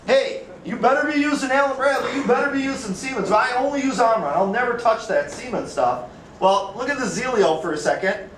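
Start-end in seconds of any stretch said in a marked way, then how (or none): 0.1-6.0 higher-pitched imitation of a stubborn brand loyalist; mock insisting on your favorite brand. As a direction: imitate a die-hard brand partisan. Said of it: "they get into these mindsets about".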